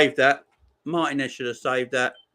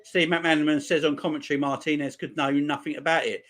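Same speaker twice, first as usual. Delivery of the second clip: ordinary talk, nothing marked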